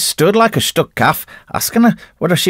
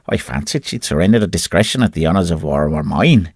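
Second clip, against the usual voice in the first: ordinary delivery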